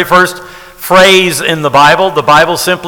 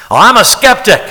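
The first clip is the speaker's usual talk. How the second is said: mock skeptic; 6 semitones higher, 4 dB louder than usual. Mock objector voicing the skeptic.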